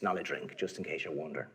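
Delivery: plain reading voice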